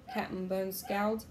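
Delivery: ordinary delivery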